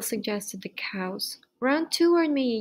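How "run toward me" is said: higher-pitched, restrained storybook yell; mild yell. A narrator's reading. Gander summons fleeing Wilbur, mild yell. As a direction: call him over, voice raised in pitch. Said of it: "yelled the gander".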